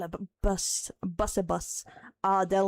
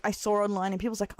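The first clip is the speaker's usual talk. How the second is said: ordinary talk, nothing marked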